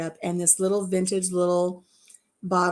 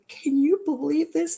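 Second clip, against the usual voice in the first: excited disbelief; 7 semitones higher than usual. Retold or imitated. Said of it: "I was like".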